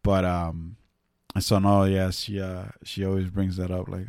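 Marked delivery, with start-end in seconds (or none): none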